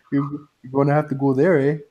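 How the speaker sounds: mock Canadian accent with eh; hammed-up Canadian accent, ending eh. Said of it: "you turned Canadian, eh?".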